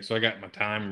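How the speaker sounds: ordinary talk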